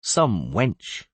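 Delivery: ordinary delivery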